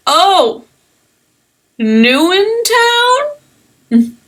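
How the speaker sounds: high, slow, drawn-out flirty greeting; flirty stranger. Acted. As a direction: draw out the line like a smooth talker sizing them up. GM voices Hawk noticing newcomers.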